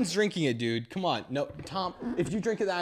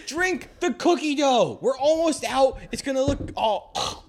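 loud, high party yelling; rowdy party animal; 11 semitones higher, 4 dB louder than usual. Real, not acted. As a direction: egg them on, high and loud. Pushing drinks at a party.

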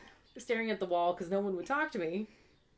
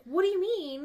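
high, louder imitation of an agitated mother; mock indignant question, pitched up; worked-up mom; 10 semitones higher, 6 dB louder than usual. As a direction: play mom getting worked up. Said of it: "she got all worked up".

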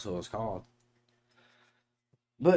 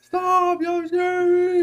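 very high, loud, slow terrified squeal; hammed-up frightened wail; mock scared shriek; 9 dB louder, much slower than usual. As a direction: shriek it like she's really spooked. Mimicking sister, terrified.

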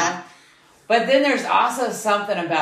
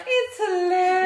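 much higher, teasing imitation of a friend; mocking imitation. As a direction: parrot her line back in a high mocking voice. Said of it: "and you're like".

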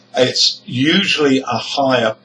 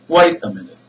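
"Wait a minute" with a very short, weak "a"; stress on WAIT and MINUTE; 3 dB louder, much faster than usual. Shown as a demonstration. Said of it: "stressed syllables, two stressed words".

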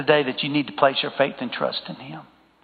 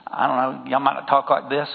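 ordinary delivery

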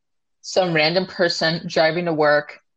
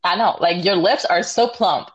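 normal talking voice

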